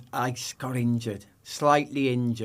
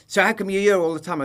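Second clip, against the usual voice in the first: higher, louder hostile interrogation; mock aggressive demand; 8 semitones higher, 6 dB louder, faster than usual. Imitating the passport officer demanding answers, loud and high. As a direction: bark the question like a rude border guard. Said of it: "Really aggressive".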